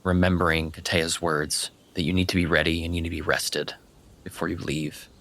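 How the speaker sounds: normal talking voice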